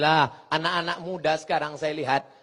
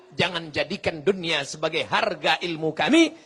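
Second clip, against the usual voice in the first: normal talking voice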